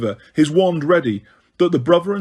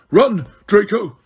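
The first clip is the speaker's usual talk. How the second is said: raised, louder warning cry; Snape's shout; 5 semitones higher, 4 dB louder than usual. A narrator's reading. Snape shouting to Draco mid-escape, urgent cry. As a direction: shout the order to flee. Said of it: "Snape shouted".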